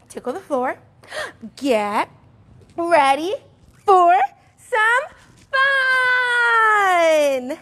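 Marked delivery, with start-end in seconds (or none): 1.6-7.6 rising to a high shout; kids' class hype call; excited. Acted. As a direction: build up to a big cheer. Building up to the song.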